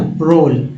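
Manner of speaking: प्रोल said slowly, as pro plus rol; pronunciation demo. Shown as a demonstration. Teacher reading the word list aloud, careful pronunciation demo. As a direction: spell out प्रोल clearly for the class. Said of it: "word है प्रोल".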